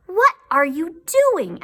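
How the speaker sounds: high, loud puzzled question; surprised question. Acted. Lamb asking the bunny with shut eyes, surprised. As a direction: ask in amazement.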